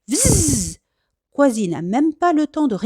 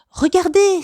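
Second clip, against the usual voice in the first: much higher, louder shout; excited cry; 9 semitones higher, 5 dB louder than usual. Acted. Kwasi spotting the moving plant, excited cry. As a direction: call out to point something out. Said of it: "s'écrie soudain Kwasi".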